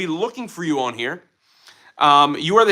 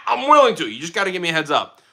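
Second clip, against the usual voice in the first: normal talking voice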